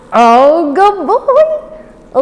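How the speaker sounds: very high, loud praise. Real, not acted. Trainer gushing over the puppy, high pitched. Praising dog after recall.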